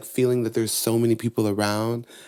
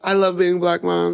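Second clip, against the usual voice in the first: put-on higher, louder little-girl voice; proud child; 8 semitones higher, 6 dB louder than usual. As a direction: announce it proudly like a small child. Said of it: "And I was like".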